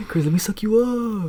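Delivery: high, slow, drawn-out imitation; mock pleading crackhead. Imitating the crackhead at his job, hammed-up pleading. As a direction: stretch the plea out high and hammy. Replays the coworker's proposition, exaggerated.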